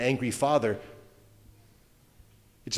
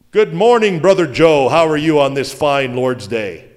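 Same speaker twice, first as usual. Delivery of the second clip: churchy Sunday greeting; 9 dB louder than usual. Retold or imitated. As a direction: put on the pious Sunday-morning mask. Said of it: "if the guy talks like that".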